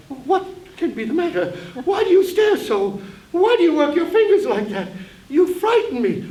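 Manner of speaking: aunt alarmed, rising fear. Acted.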